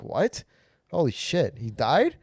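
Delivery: high, rising disbelief; acted-out shocked reaction; mock shock. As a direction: react stunned, pitch jumping up. Acts shock at neighbor's death.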